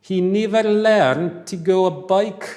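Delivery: slow, loud Scottish accent. Shown as a demonstration. Teacher reads the sentence to class in Scottish accent. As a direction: read it broad Scottish, slowly. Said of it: "read with a Scottish accent".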